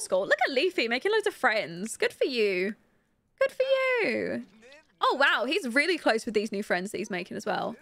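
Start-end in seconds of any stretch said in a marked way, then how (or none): 3.4-4.4 very high, drawn-out cooing; squeaky, stretched-out encouragement; cutesy cheer. Pretend. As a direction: coo it slowly in a high little voice.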